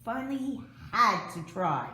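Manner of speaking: plain reading voice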